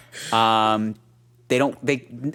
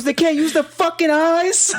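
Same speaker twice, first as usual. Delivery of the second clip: much higher hammed-up angry dad; angry Jersey dad; 5 dB louder than usual. Mimicking the dad arguing with mom, much higher mock voice. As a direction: squeal the dad's rant in a mock voice. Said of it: "he goes".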